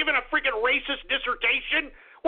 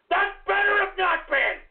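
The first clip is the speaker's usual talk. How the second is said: angry shout; 6 semitones higher than usual. Real, not acted. Host threatening a rival caller.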